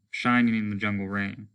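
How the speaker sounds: usual speaking voice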